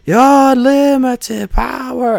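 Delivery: high, drawn-out villain cry; over-the-top imitation of a movie villain; Ian McDiarmid impression. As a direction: stretch it out as a high-pitched theatrical boast of power. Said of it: "You get Samuel Jackson and Ian McDermott".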